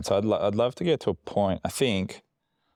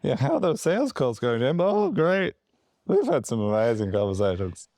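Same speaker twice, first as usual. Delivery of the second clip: put-on drunk guy from the forties; 7 semitones higher than usual. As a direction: slur it like a forties barfly. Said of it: "You just sound like a drunk guy from the forties".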